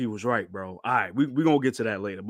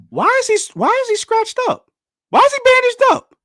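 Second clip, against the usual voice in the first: very high, loud squealed questions; alarmed disbelief; 7 dB louder than usual. Real, not acted. Streamer seeing Shanks bandaged, alarmed squealing.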